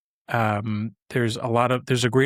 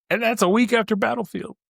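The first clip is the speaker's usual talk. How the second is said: higher, building eagerness over the packed release schedule; rising excitement; 10 semitones higher than usual. Real, not acted. Podcaster stacking up release dates, getting more excited. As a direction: pile on the dates with growing excitement.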